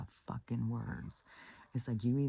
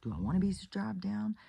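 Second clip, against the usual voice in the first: imitating husband's doubts; 7 semitones higher than usual. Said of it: "and I'm like".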